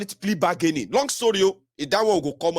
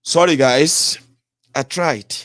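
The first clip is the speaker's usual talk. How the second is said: mock apology; 2 semitones lower, 7 dB louder, slower than usual. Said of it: "And I'm trying to be like".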